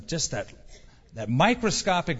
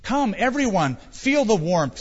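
higher, calling the group over; eager invitation; 6 semitones higher, 3 dB louder than usual. A narrator's reading. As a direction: invite everyone in with enthusiasm. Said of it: "she invited".

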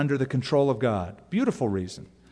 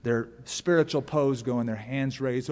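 normal talking voice

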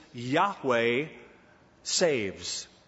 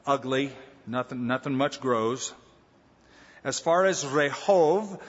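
ordinary talk, nothing marked

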